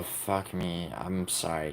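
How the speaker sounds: ordinary talk, nothing marked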